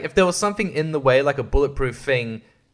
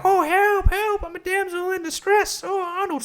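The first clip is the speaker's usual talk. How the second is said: high mocking damsel voice, much higher; fluttering cries for help; slower than usual. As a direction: squeal it like a helpless damsel. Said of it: "she's like".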